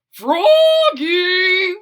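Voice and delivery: loud high call across the house. Acted. Father calls for Froggy from another room, loud. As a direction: call him from far off. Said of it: "called his fa-, father".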